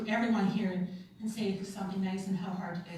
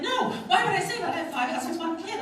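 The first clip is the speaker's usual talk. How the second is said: laughing self-correction; 8 semitones higher, 5 dB louder than usual. Retold or imitated. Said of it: "I'm like".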